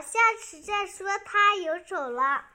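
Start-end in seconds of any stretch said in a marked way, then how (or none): none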